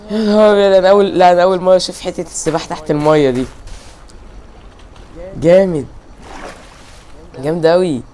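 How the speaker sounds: loud, high burst of wonder; can't believe what he's seeing; loud amazed gamer reaction; excited amazement. Real, not acted. First sight of underwater swimming.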